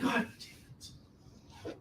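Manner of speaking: slow, whispered curse under the breath; muttered curse. Acted. Joe cursing to himself as Phil refuses to eat, whispered.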